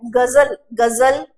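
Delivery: गजल with a buzzing z in the middle, gazal; actual pronunciation. Shown as a demonstration. Teacher giving the actual pronunciation, गजल with buzzed z. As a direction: put z, not j, inside गजल. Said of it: "actual में जो pronunciation है".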